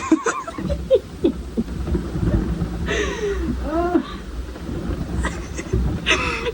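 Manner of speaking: real laughter at her own story; long high laugh. Real, not acted. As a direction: break into a long giggling fit.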